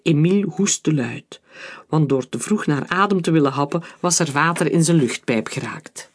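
usual speaking voice

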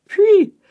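high, loud imitated bullet whizz; whistling shot noise. A narrator's reading. Narrator imitates a shot hitting Joseph, high whizz.

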